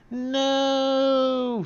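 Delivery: high, loud cartoon "No!"; silly high cry. Slavo about to explode, a high loud cry. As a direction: yelp before blowing up.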